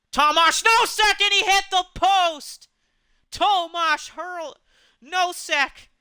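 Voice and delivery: sudden rush of excitement on a near goal; excited call. Real, not acted. Goal post hit in play-by-play.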